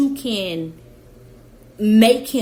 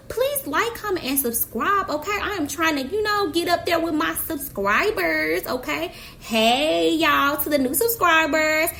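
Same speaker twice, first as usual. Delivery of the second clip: bubbly outro; 6 semitones higher than usual. Real, not acted. Vlogger outro to viewers.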